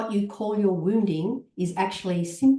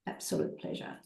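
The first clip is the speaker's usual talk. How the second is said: whispered; 11 dB quieter than usual. Real, not acted. Soft warm thanks.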